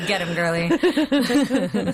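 put-on slow, stretched-out "get 'em, girly."; a playful cheer drawn out long; cheering drawl. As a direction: cheer them on slowly, drawing it out. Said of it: "I'll be like".

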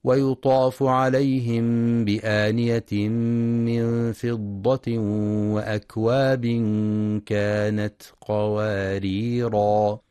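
slow, drawn-out melodic tartil; slow Quranic recitation. Acted. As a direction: chant the verse with measured tajweed.

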